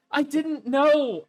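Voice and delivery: slow, stunned mock lament; drawn out in fake disbelief; mock dismay. Mock shock, repeated.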